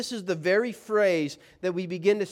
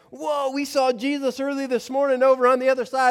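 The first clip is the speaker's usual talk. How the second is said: higher, louder amazed report; excited crowd; 8 semitones higher, 5 dB louder than usual. Retold or imitated. Crowd members report seeing Jesus, excited. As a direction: gush the news. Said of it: "and they're like".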